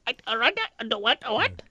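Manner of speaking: high puzzled repeating; mock confused barista. Mimicking an airport barista, high confused voice. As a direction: raise the voice, baffled. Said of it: "I'm gonna do what?".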